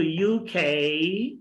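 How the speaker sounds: slow U K as "you-khay"; drawn-out U K for copying; say after me. Shown as a demonstration. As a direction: stretch U K with a puffed K. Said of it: "try to say after me".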